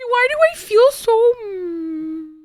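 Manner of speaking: high, half-laughing cry, hammed-up; mock sobbing. Real, not acted. Streamer half-jokingly sobbing to chat about a sad movie. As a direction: wail it out like a crying fit, half joking. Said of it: "I'm actually crying".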